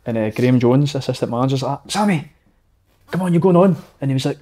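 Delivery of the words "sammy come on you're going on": imitated touchline call, raised in pitch; higher, urging call to a player. Assistant manager summoning a substitute, calling out.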